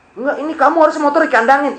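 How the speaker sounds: high, insistent policeman voice; a put-on officer laying down the rule; insistent cop. Police officer insisting the motorbike be impounded, high and firm.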